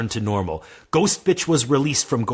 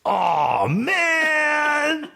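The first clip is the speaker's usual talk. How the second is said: disappointed shout. Acted. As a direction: wail it like a kid denied a toy. Hero learns he's a wizard.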